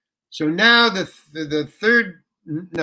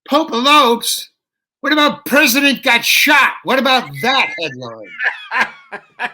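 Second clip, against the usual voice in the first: "Pope elopes?" much higher and louder, slow and disbelieving, then a laugh; incredulous outburst; 7 semitones higher, 6 dB louder, slower than usual. Retold or imitated. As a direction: throw the silly headline back, outraged. Incredulous retort to the heckler.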